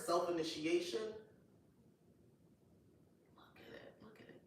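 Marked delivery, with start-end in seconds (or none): 3.3-4.4 soft whisper, drawn in; hushed whisper. Real, not acted. Vlogger to viewers, whispering over a beautiful card. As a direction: whisper it in wonder.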